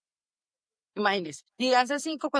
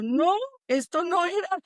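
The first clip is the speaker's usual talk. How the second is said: dismayed disbelief; 9 semitones higher, slower than usual. Retold or imitated. Realizing army wasn't what expected.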